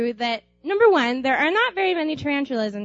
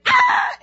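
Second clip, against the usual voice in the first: piercing high shriek; loud, very high terrified scream; 6 dB louder than usual. Retold or imitated. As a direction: scream at the sight of the spider. Friend sees spider and screams.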